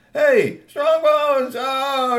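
very high, loud joyful yell; excited shout. Acted. Friend running out to greet Strongbones, loud and high. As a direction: call out across the yard.

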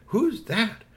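put-on high child voice, moderate volume; curious little girl. A narrator's reading. First girl asks about stranger.

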